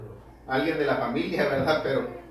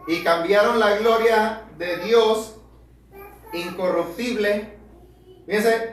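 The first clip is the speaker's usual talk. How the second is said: louder, higher preaching that builds, climbing to a peak on hermanos; fiery preaching; 4 semitones higher, 7 dB louder than usual. Acted. Preacher reading Romans to his brothers, preaching at full force. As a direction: thunder the verses at the congregation.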